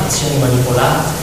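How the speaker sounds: ordinary talk, nothing marked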